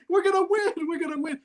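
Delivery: high, excited victory cry; excited retold cheer. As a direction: blurt it out like a winning bettor. Retelling excited celebration shout.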